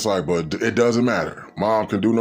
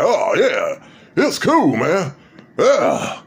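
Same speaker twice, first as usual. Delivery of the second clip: pleased friend; 7 semitones higher, 4 dB louder, much slower than usual. Retold or imitated. Friend pleased with cheap gift.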